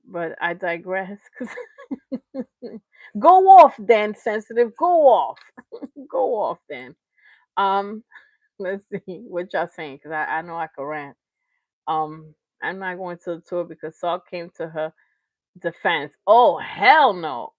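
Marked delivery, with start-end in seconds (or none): 3.2-7.0 loud, teasing go off; louder sarcastic cheer; mock hype. Real, not acted. Teasing someone sensitive. 16.3-17.5 slow, emphatic oh hell no; slow and louder refusal; drawn-out disbelief. Real, not acted. Reacting to Marion's defense.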